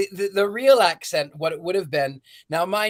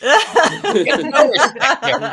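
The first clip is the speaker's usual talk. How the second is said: loud, high-pitched comedian catchphrase voice; hammed-up comic complaint, pitched up and loud; Rodney Dangerfield; 6 semitones higher, 9 dB louder, slower than usual. Podcast host riffing on Back to School, loud comedian impression. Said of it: "Back to School with Rodney Dangerfield".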